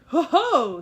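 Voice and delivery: loud, booming giant's triumphant ho ho; cried. A narrator's reading. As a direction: bellow it in triumph. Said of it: "he cried at last".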